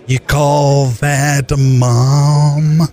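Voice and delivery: slow, drawn-out Nicholson sneer; Jack Nicholson impression. Comic joking his mom is no mom, slow Nicholson voice. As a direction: lean into a lazy Nicholson drawl. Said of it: "That's my Jack Nicholson".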